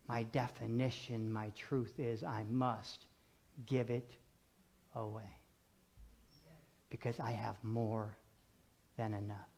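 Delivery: soft, low, with long pauses; hushed and solemn. Real, not acted. Preacher confiding a mission to congregation, hushed and solemn. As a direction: let each phrase settle quietly.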